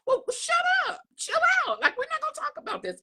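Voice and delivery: very high shout, fast at the end; exasperated shout. Retold or imitated. Telling the friend to drop politics, shrill exasperated shout. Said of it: "I said".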